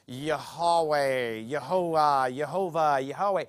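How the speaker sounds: Yahweh and Yehovah drawn out as rival versions; mock pronunciation correcting. Pastor imitating objectors fussing over the name, mock-careful pronunciation. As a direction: try Yahweh, then Yehovah, slowly sounding out each variant. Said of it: "you're pronouncing that name right".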